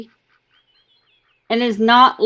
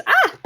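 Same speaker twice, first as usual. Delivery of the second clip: startled yelp. Real, not acted. Cat startles her.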